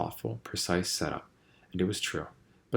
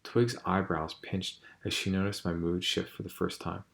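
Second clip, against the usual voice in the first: plain reading voice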